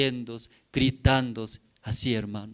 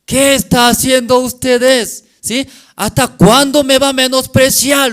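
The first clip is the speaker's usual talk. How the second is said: loud, high-pitched outraged rebuke; acted divine fury, voice raised hard; angry God; 10 semitones higher, 11 dB louder than usual. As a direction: thunder at them as an offended God. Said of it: "Dios se enojó".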